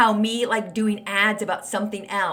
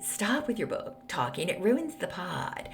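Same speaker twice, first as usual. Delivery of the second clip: mocking complainers; 3 semitones lower, 9 dB quieter, slower than usual. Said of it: "people have said".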